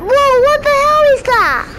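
high, loud startled cry. Real, not acted. Child startled by something in the game, loud high whoa. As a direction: blurt it out in sudden shock.

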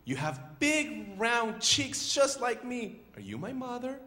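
high little-bird voice; high, drawn-out baby bird; Choco. Acted. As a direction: plead hopefully as a lonely chick. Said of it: "How about you be the voice of Choco?".

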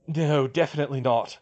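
firm but pained. A narrator's reading. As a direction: say no as if it hurts. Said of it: "Bill said with a pained sound".

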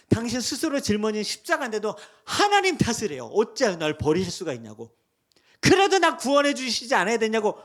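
loud, pleading complaint to God; a loud pleading lament. Retold or imitated. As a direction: protest loudly, half accusing.

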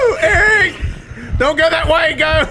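high, loud laughing shout; panicked shouting. Real, not acted. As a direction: scream at your friend to run. Partner going wrong way.

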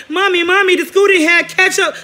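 high, loud cry for mommy; mock a kid urgently tattling to mommy; whining child. Roasting him as a kid calling mommy, high whining child.

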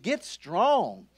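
ordinary delivery

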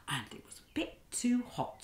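whispered aside about the hot porridge; hushed whisper. Acted. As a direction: whisper the secret.

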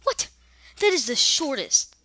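loud, swooping exclamation of disbelief; outraged shout. Real, not acted. Gamer sees the level timer, outraged shout. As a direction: burst out in indignant protest.

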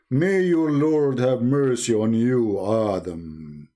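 solemn god voice. Retold or imitated. Allah blessing Adam after his sneeze, low and solemn. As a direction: intone it like a grave deity. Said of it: "and I say to you".

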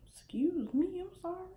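hushed whispered apology, quick. Retold or imitated. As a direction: whisper it back sheepishly. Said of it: "I'm like".

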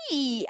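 high, drawn-out yelp; howled "ay". Acted. Little leopard squeezed by the crocodile, howling. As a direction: wail one long "ay". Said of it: "aúlla Leopardito".

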